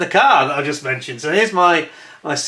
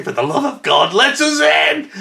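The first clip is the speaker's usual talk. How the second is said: retold desperate plea; 11 semitones higher, 4 dB louder than usual. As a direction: build to a high, loud shout. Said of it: "I'm pounding on the door saying".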